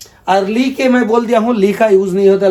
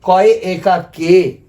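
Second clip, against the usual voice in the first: कॉय एकार के said very slowly, syllable by syllable; teacher slowly voicing कॉय एकार के for pupils; slow demonstration; much slower than usual. Shown as a demonstration. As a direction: model कॉय एकार के slowly for students to copy. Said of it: "माने बांग्ला में हम लोग बोलेंगे".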